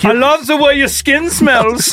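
put-on creepy German accent, slow and high; slow, breathy German creep, high. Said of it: "with a thick German accent".

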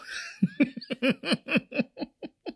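high, staccato fit of giggles; long, high-pitched chuckle; laughter. Real, not acted. Server recalling the slug on the table, high laughter. Laughing at her own story.

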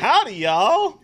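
loud, drawn-out Southern twang; slow, high, hammed-up Southern greeting; Paula Deen. Co-host repeats Poe-as-Southerner joke, Southern drawl. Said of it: "Just, like, went into Paula Deen".

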